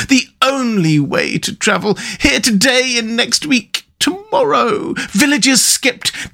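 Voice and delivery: high, slow, swooning Toad voice; a dazzled toad lost in a dream of the open road; Toad in raptures. Acted. Toad rhapsodises about the road.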